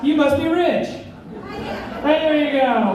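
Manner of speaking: ordinary talk, nothing marked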